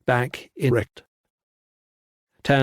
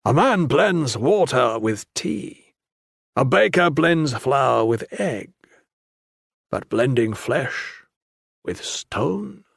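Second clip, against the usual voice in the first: slow, dry, mocking monk; slower than usual. A narrator's reading. Tan's slow mocking musing.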